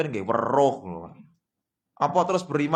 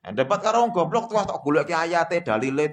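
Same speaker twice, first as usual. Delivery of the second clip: ordinary talk, nothing marked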